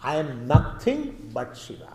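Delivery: slow, weighted "nothing"; deliberate line with "nothing" standing out; emphasis on nothing. Shown as a demonstration. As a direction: stretch out "nothing" to stress it. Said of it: "Because to emphasize".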